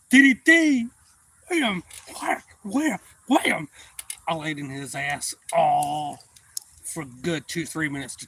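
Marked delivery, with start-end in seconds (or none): 0.1-3.7 very loud, high shouted move name; shouting the move. Retold or imitated. Boy announcing his wrestling move, shouted.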